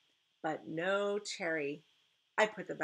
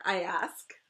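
ordinary delivery, nothing marked

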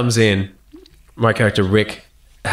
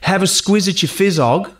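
random slang variant; 6 semitones higher than usual. Retold or imitated. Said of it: "one take where he said".

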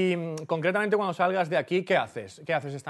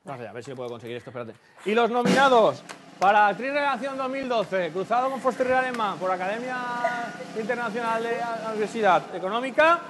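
award presenter; 6 semitones higher, 4 dB louder than usual. Pretend. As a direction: present the nominees with pomp. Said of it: "lo hagamos aquí un poquito".

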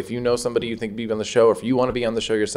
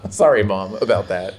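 usual speaking voice

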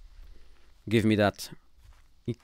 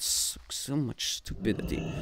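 softer, breathy muttering; whispered grumble; 11 dB quieter than usual. Real, not acted. Gamer grumbling about the quest under his breath.

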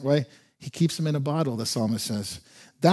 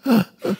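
sharp, high catching gasps; demonstrated sob-gasping; sobbing child's gasps; 7 semitones higher, 5 dB louder than usual. Shown as a demonstration. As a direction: imitate a little kid who can't catch a breath from sobbing. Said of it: "just can't even catch your breath".

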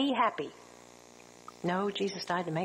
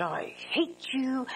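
hushed breathy whisper, slow; retold whisper; 3 semitones higher, slower than usual. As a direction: whisper the spite, barely voiced. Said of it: "and she said".

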